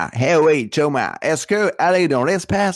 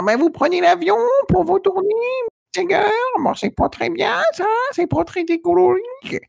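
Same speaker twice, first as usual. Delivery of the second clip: strut it like an aging rock star; 10 semitones higher than usual. Acted. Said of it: "C'est quand il fait [name]".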